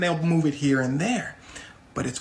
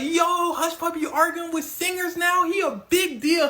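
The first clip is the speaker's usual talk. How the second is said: high-pitched hyped crowd voice; excited onlookers; 11 semitones higher than usual. Retold or imitated. Fans react to Hush Puppy arguing with singers, hyped. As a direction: play amazed fans, pitched way up.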